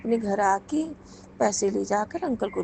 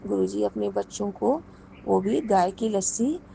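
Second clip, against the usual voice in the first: normal talking voice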